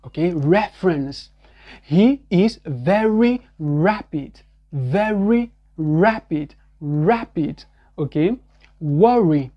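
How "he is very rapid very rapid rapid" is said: slow "very rapid" with an English R. Shown as a demonstration. Teacher drilling rapid for learners, slow. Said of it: "le mouvement".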